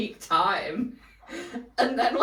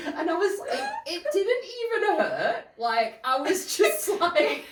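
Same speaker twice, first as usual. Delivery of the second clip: laughing; 9 semitones higher than usual. Real, not acted. Laughing through the story.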